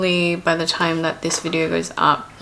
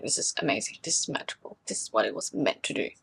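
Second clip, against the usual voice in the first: hushed amazement; 6 semitones lower, 9 dB quieter, faster than usual. Retold or imitated. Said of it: "I was like".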